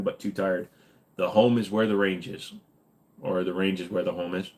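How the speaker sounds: ordinary talk, nothing marked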